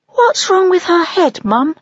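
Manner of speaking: high, loud puzzled owlet; a child's worried question, pitched high; owlet asking. Acted. Soren asking his mother about the chick, high and puzzled. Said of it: "Soren asked".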